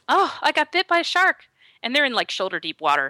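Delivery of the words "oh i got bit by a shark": high. Retold or imitated. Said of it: "and she says".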